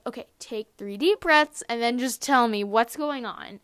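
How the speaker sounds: lightly voiced mom talking a child down; retold coaxing mom. Mom telling crying daughter to breathe, lightly imitated coaxing.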